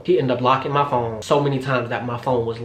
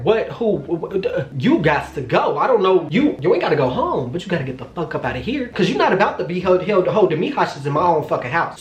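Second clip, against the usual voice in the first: high, stammering disbelief building to indignant orders; sputtering outrage; 4 semitones higher than usual. Retold or imitated. Woman ordering out boyfriend who refuses to leave, indignant sputter. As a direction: trip over the words, too incredulous to finish.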